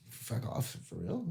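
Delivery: hushed, breathy disbelief; whispering. Retold or imitated. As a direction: whisper it in shock. Retold stunned reaction to news.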